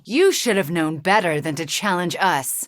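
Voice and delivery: ordinary talk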